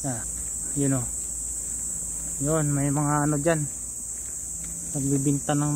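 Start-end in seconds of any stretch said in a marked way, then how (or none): none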